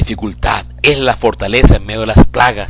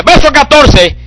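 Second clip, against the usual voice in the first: much higher and louder, crying out; preaching at full force; 8 dB louder than usual. Acted. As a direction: shout the verse over the congregation. (predicación a todo pulmón, mucho más aguda)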